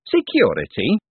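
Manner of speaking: rising, savored Security; tasting the word. A narrator's reading. Foreman to Moist, tasting the word. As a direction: mull the word slowly. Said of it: "as if tasting the word".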